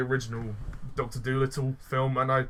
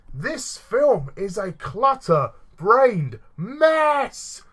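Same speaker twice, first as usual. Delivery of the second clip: slow dramatic outburst; 8 semitones higher, 6 dB louder, much slower than usual. Acted. Exaggerated verdict in the rant.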